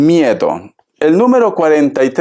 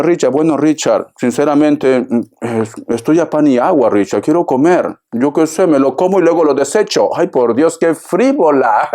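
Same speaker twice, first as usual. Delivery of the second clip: ordinary talk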